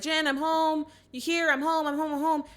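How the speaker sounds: high, singsong mock call through the house. Husband to wife on coming home, acted calling out.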